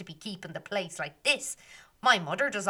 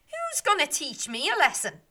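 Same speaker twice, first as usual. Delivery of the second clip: higher and louder taunt; taunting shout; 8 semitones higher, 5 dB louder than usual. Acted. Meg taunting the fairies, higher and loud. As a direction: throw the dare out loud. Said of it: "she shouts".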